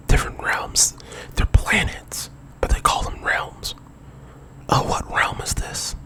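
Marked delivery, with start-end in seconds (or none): none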